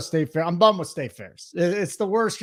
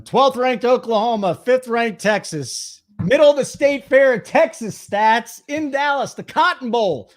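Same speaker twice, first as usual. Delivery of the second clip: sports preview voice; 3 semitones higher than usual. Acted. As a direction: set up the matchup like a broadcaster. Announces the game like a preview.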